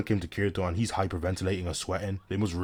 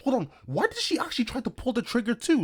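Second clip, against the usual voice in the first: high-pitched disbelief. Pretend. Narrator questions Sinon pulling the trigger, high incredulous voice. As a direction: voice shooting up, incredulous.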